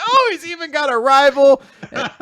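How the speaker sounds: high, laughing, thrilled exclamation; delighted laughing dad. Retold or imitated. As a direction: burst out happily, laughing as you say it. Dad delighted at son's rival.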